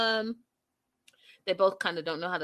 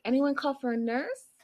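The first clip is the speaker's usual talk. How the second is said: flirty nurse quote; 6 semitones higher, 3 dB quieter than usual. Retold or imitated. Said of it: "Chantel's like".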